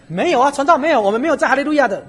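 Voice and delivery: loud, high blunt denial; imitating the brother. Preacher playing the brother's blunt loud answer. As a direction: blurt it out without a second thought. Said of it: "他连想都没有想".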